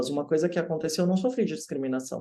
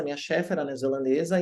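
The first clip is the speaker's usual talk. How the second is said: usual speaking voice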